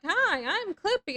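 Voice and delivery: high, loud, slow cheery paperclip voice; Clippy voice. Retold or imitated. Streamer mimics the paperclip assistant, high cheery chirp. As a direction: chirp it like an eager helper. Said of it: "He's like".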